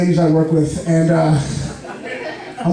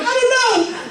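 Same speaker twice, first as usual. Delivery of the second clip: much higher, helpless whine; high panicked squeak; 10 semitones higher than usual. Retold or imitated. Comic high-voiced patient answering the paramedic. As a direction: squeak it out, lost and scared. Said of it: "I'm like".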